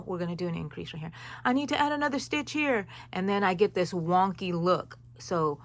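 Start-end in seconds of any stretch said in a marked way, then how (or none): 1.4-2.9 fretful self-quote. Retold or imitated. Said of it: "I have a bad habit of saying".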